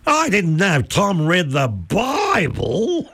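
high, drawn-out imitation of brother's outburst; hammed-up calling from the audience; mock loud exclamation. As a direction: stretch it out high, as if astonished for all to hear. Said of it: "heard to say quite loudly from the front row".

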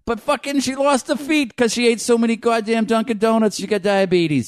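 hammed-up Boston local. Bostonian explaining mother's lost feet, comic Boston rant. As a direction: tell it as an indignant Bostonian.

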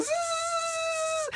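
long high buzzing zzz; soft, sustained high whine; imitated reel noise. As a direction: buzz like line screaming off a reel. Imitates the fishing reel running out.

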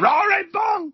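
very high, loud shout of a horse name; shouted exclamation. Shown as a demonstration. Commentator calling a horse named with an exclamation mark, shouting. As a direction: yell it with a huge exclamation mark. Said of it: "roaring bull exclamation".

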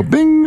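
meditation bell imitation. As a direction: ring it out high like a chime. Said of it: "And he'd go".

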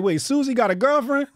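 high, loud mock disbelief; hammed-up incredulous question; mock shock. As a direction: retell your shock, loud and high-pitched. Retelling his shocked reaction to Duolingo.

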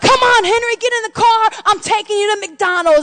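high, loud retold summons; calling him over. Retold calling Henry into car.

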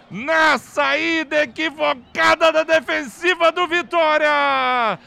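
high, loud celebratory recap; excited goal recap. Real, not acted. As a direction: relive the goal, shouting. Goal replay narration. (narração empolgada do gol)

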